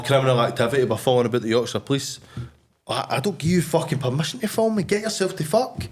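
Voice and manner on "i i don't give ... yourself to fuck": higher, pitched-up mock telling-off; retelling how he told the film crew off; angry retort.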